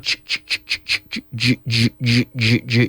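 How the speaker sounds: "ch" turning into voiced "j"; sound drill. Shown as a demonstration. Teacher drilling the J sound for students. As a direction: buzz from "ch" into "j" by adding voice. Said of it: "activate the vocal cords with the C H sound".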